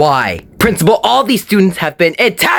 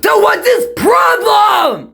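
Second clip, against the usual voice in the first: high, drawn-out cry of frustration; slow, high-pitched indignant question; exasperated shout; 9 semitones higher, much slower than usual. Acted. Frustrated outburst about the teacher.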